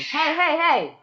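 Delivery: high, loud shout at the cats; loud real scold. Real, not acted. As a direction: stop the cats fighting right now. Cats fighting in the room.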